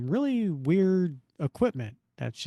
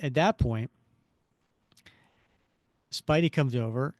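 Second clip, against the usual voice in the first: ordinary delivery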